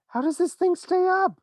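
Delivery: high, loud worried question; anxious cry. A narrator's reading. Grandpa Joe hovering in the sky, anxious. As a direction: cry out in worry. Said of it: "cried Grandpa Joe".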